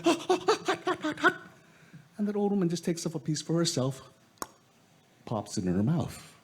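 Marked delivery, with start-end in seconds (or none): 0.1-1.4 high, yelping hot hot hot; girl burned. Acted. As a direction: squeal at the burning food.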